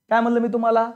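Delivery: ordinary talk, nothing marked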